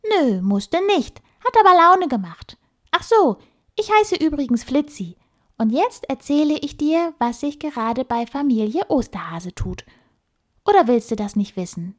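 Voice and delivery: high squeaky little animal voice; tiny bossy critter, high and quick. Acted. The squirrel Flitzi introduces himself. (piepsige Stimme)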